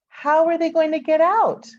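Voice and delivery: put-on high, loud worried child's question; the boy blurting out his worry; little boy. A narrator's reading. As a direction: ask like a small boy suddenly alarmed. Little boy's sudden worry.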